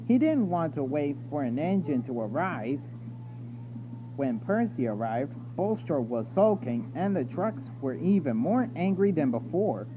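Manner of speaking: usual speaking voice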